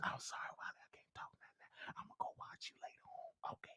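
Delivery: hushed whisper so the man won't hear; whispering an apology to Wiley; secret whisper. Retold or imitated. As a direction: breathe it out secretly on the phone. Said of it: "you gotta whisper".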